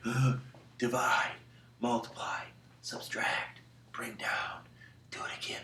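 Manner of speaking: hushed, whispery sleep-talk; sleepwalker mumbling. Acted. Sleepwalking child answering a parent, whispered sleep-talk. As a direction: chant it softly as if asleep. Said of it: "you start saying it".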